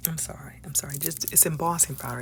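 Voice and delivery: soft unvoiced sorry; embarrassed whisper; whispered apology. Real, not acted. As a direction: whisper it sheepishly. Sheepish apology for being hyper.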